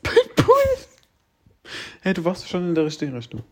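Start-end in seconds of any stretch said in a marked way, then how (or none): 0.0-0.9 very high, squeaky laughing echo; incredulous high-pitched mimicry; mocking echo. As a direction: parrot it back way up high, laughing. Mockingly echoes the other's guess.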